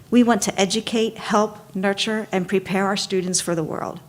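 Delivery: normal talking voice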